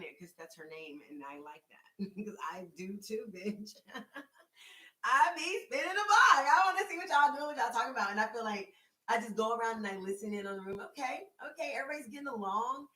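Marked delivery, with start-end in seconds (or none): 5.0-8.1 playful hollering. Acted. Owning up to nosiness to the room, playful hollering. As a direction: holler it out high and proud. Playfully calling out her nosy habit.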